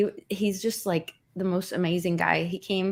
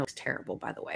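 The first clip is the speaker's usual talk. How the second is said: whispered aside; 7 semitones lower, 12 dB quieter, faster than usual. Real, not acted. Quiet aside about show.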